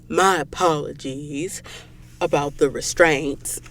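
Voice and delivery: low, slow, badly faked Southern accent; Southern drawl like a bad Forrest Gump. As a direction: put on a clumsy Southern drawl. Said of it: "sound like a bad Forrest Gump".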